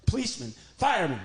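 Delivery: usual speaking voice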